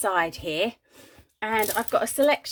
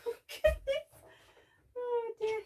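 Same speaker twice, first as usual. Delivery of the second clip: laughing softly; 13 semitones higher, 10 dB quieter than usual. Real, not acted. Laughs at lost mat.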